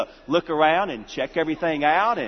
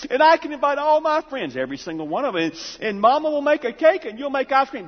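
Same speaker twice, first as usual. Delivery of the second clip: put-on excited little boy; 7 semitones higher than usual. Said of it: "he says".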